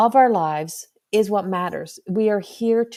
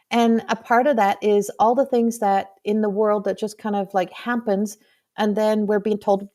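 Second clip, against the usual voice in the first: ordinary talk, nothing marked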